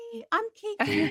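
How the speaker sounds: high-pitched voice for a dog; friendly dog voice. Acted. Dog introducing herself to other dogs, high friendly voice.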